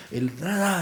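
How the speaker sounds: imitating clipped military talk; mock a soldier's staccato way of speaking, lightly; habla militar golpeada. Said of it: "el hablar golpeado". (imitación leve del hablar golpeado militar)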